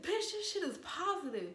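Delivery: a stunned, rising exclamation; shocked exclaim. Retold or imitated. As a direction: break the news in disbelief. Shocked reveal of positive test.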